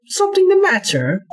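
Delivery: ordinary delivery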